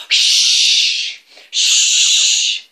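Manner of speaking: loud high shushing hiss; fire hissing sound. Acted. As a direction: hiss like dragon flames. Dragon breathing fire sound.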